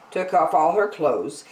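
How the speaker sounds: usual speaking voice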